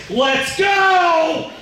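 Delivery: high, loud rallying yell; shouted command. Acted. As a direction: call down to the crew from up in the bucket. Pete calls down from the bucket.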